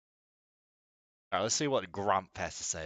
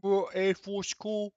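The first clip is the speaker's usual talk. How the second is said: mock British accent; 5 semitones higher than usual. Mock British soldier rant.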